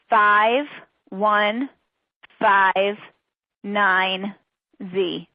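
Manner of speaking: very slow, each character separate; slow repeated dictation. Real, not acted. Presenter repeats the credit code slowly. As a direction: leave a clear pause after each character. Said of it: "I will read them slowly".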